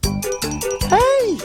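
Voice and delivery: high, gleeful "hey"; whooping with delight; excited cheer. Acted. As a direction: cheer along to the dance music. Puppet cheers while dancing to music.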